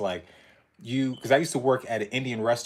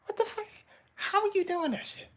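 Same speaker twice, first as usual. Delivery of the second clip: high, breathy mock astonishment, much softer; stunned disbelief; 8 dB quieter than usual. Viewer imitating his reaction to Vin Diesel stunts, high and stunned. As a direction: gasp it out, amazed and half laughing. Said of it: "that I be like".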